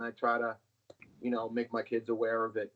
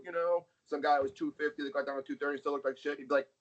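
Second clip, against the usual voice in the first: ordinary talk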